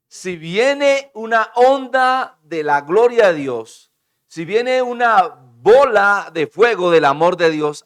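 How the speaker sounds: loud, slow, swelling cry; preaching shout. Real, not acted. Preacher booming slowly to the congregation about God's fire. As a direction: thunder it out to the church.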